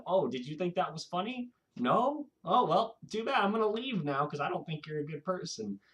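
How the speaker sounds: high, taunting sprite; smug pixie. Retold or imitated. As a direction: tease the victim like a gloating little fairy.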